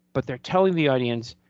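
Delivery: usual speaking voice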